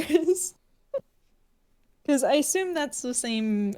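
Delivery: usual speaking voice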